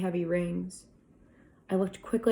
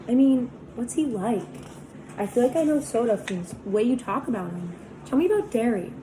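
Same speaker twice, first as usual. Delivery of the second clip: put-on higher, quicker curious girl voice; lightly voiced curious teen girl; Cherry, curious; 5 semitones higher than usual. A narrator's reading. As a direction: coax him to open up, lightly voiced.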